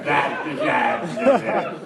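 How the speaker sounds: mock dribbling idiot. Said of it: "dribbling out of our mouth going".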